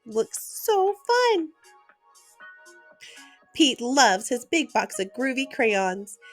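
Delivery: usual speaking voice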